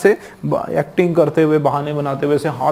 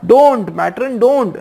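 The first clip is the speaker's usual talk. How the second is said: higher, pleading protest; mock pained cry; 7 semitones higher, 4 dB louder, much slower than usual. Schoolboy begging the matron to stop prodding, mock pained cry. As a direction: cry out as if it hurts, without full force. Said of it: "इस तरीके से वो चिल्लाने लगा".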